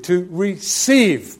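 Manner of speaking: "receive" drawn out slowly, second syllable stressed; slow, deliberate emphasis on "receive". Shown as a demonstration. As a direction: lean hard on "receive", stretching it out. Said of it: "let me emphasize the word receive".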